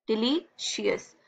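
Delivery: "Delicious" slowly, with a break before शियस; syllable division. Shown as a demonstration. Teacher dividing a word by vowels, syllables apart in "delicious". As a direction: split "delicious" into Dili and शियस. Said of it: "ये दूसरा syllable हो गया".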